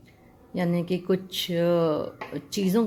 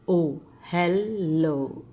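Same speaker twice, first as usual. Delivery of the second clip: ओ, hello stretched very slowly, letter by letter; slow spelled-out model; much slower than usual. Shown as a demonstration. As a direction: drag it out for the class. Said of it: "एच ई एल एल". (धीरे धीरे अक्षर जोड़कर)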